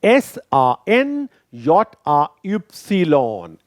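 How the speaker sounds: letter by letter, slow and even; spelling aloud slowly. Shown as a demonstration. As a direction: spell each letter out for the learner. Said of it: "spell करके".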